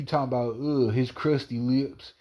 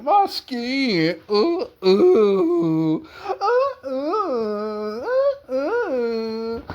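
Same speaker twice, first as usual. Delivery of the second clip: very high, wailing cry over her skin; hammed-up sobbing wail; mock wailing; 10 semitones higher, much slower than usual. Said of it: "You was crying so hard about your beautiful skin".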